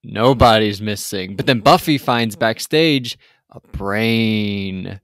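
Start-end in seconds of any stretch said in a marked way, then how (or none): none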